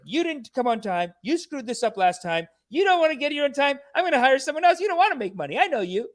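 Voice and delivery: high-pitched imitated scolding; lightly hammed-up boss telling off the workers; mock yelling. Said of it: "just yelling at them".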